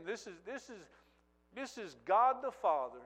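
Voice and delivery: ordinary talk, nothing marked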